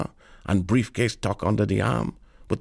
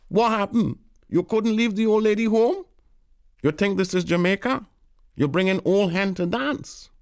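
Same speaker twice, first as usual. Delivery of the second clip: put-on the boys jeering Tolroy about the old lady; mocking the boys; 10 semitones higher than usual. A narrator's reading. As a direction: give him tone.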